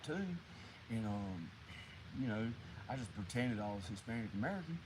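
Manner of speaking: normal talking voice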